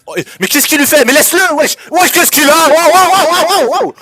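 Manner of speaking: loud, high stunned yelling; excited disbelief. Real, not acted. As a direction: yell in total disbelief. Co-caster reacts to wild play.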